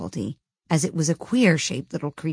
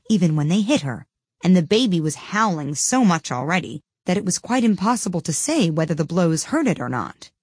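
plain reading voice

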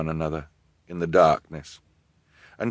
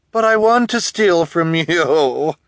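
high, loud, slow villainess; gloating villainess; 10 semitones higher, 7 dB louder, slower than usual. A narrator's reading. Guardian answering orphan's protest, openly gloating. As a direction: savour the threat, pitched up.

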